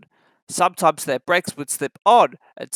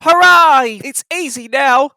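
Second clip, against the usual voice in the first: much higher, loud hurrah; cheering; 9 semitones higher, 9 dB louder than usual. Acted. James rejoicing on the hill, loud and high. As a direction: cheer like you've won.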